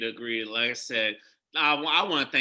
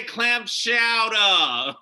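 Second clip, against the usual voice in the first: loud mock Boston accent; 9 semitones higher, 8 dB louder, much slower than usual. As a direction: exaggerate a Boston accent, half laughing. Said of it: "You don't sound like you're from Boston".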